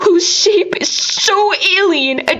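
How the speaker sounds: high, strained voice close to breaking, crying through the words; anguished lament. A narrator's reading. Narrator mourning his ruined body, voice breaking with anguish. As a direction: let the grief crack through as he describes what he has become.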